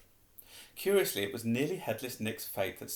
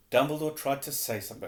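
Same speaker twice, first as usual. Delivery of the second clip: usual speaking voice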